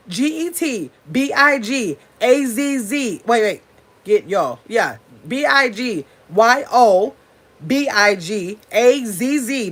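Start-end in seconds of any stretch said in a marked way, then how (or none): 0.1-3.2 slow spelling "G E T B I G A Z Z", each letter apart; spelled out letter by letter. Shown as a demonstration. Spelling out her channel name for viewers, slow and deliberate. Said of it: "let me get it for y'all". 5.3-9.7 slow spelling "G E T B I G A Z Z", each letter apart; spelled out letter by letter. Shown as a demonstration. Spelling out her channel name for viewers, slow and deliberate. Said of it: "not A S S".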